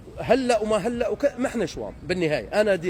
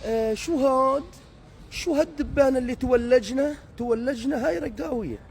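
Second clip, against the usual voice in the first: imitating a Damascene; 5 semitones higher than usual. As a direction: play the snobbish Damascene, sniffing at the newcomer. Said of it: "يقول لك". (تقليد متعالٍ للهجة الشامية)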